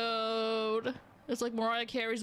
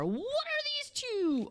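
high, drawn-out exclamation of surprise; astonished; 14 semitones higher, much slower than usual. Real, not acted. Player spotting two unexpected things, high astonished cry. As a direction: shoot the pitch up and stretch it in disbelief. Startled by sight in game.

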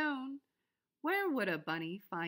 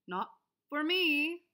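higher, slow no thanks; bunny refusing; 6 semitones higher, 6 dB louder than usual. A narrator's reading. Bunny turning down the bog, higher and slow. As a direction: politely decline like a bunny. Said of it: "said the bunny".

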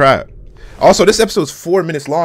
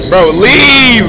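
high, loud, drawn-out plea; urgent yell; 8 semitones higher, 9 dB louder than usual. Real, not acted. Watcher begging the character to retreat, urgent shout. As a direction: holler the last word long and high.

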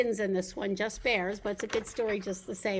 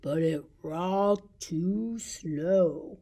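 slow drawn-out telling; 7 semitones lower, slower than usual. Acted. Reading Three Bears to babies, acting the too-slow rocker slowly. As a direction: lower and very slow.

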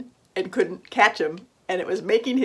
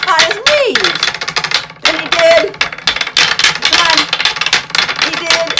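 excited storyteller; 10 semitones higher, 10 dB louder than usual. A narrator's reading. Narrator cheering the cookie's tricks.

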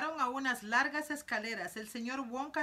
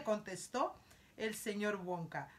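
ordinary delivery, nothing marked

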